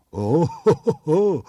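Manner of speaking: agudo y asombrado, oh oh; sorpresa de oso. Acted. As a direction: exclama con asombro creciente. (oso sorprendido)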